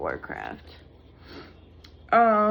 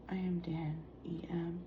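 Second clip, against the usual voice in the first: whispering; 2 semitones lower, 13 dB quieter than usual. Retold or imitated. Repeating the tweet.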